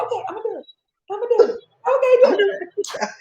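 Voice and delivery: high, comic burst of determination; gaining resolve. Retold or imitated. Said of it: "to a confidence that I've seen".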